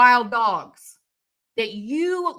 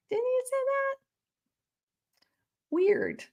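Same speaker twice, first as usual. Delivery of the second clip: mocking; 6 dB quieter than usual. Mocking the police chief.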